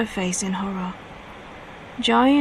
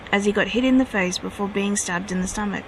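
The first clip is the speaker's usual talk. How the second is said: ordinary delivery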